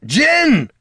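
very high, loud cry of a name; urgent yell across a battlefield; warning shout. A narrator's reading. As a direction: shout to warn someone in danger. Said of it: "Gorm shouted".

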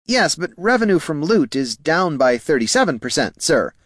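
deferential junior clerk. Acted. Clerk correcting his boss on revenue, deferential. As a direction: politely contradict the boss in a high voice. Voices clerk Poldo in dialogue.

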